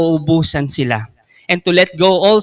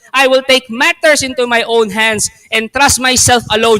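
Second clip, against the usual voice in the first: defiant shouting; 8 semitones higher than usual. Acted. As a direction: yell it back in stubborn defiance. Defiant guests shout at host.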